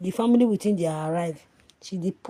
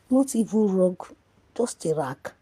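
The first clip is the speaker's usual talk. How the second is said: ordinary delivery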